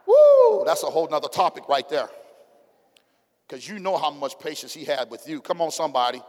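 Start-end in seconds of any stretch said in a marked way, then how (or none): 0.1-0.6 a high, loud joyful "Woo"; preacher's whoop. Real, not acted. Preacher to congregation, a high loud joyful whoop. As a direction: let out a whoop of praise. Preacher exclaims mid-sermon.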